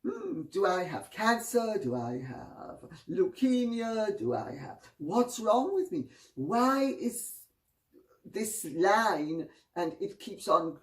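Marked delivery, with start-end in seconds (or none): 0.1-9.5 much higher, alarmed self-questioning; high, anxious hypochondriac worrying; panicked inner voice. Retold or imitated. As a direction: fret aloud like a vain man spotting a flaw on his face. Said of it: "and he thinks".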